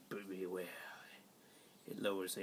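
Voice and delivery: soft unvoiced whisper. Real, not acted. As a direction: hush it like a warning. Whispered warning.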